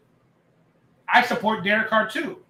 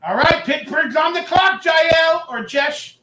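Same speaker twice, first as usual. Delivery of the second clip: shouted announcement, higher; shouted draft call; loud announcing; 7 semitones higher, 5 dB louder, slower than usual. Real, not acted. Announcing team on the clock.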